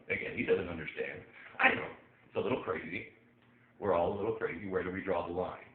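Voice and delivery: ordinary delivery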